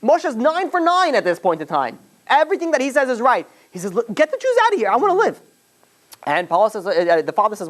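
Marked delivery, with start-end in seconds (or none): 3.9-5.4 desperate plea. Retold or imitated. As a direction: beg for your life, voice climbing high.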